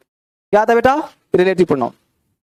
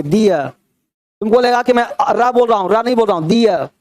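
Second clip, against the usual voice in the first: usual speaking voice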